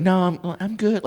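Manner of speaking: a hint of a polite girl brushing him off; man doing a woman's voice. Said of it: "she's like".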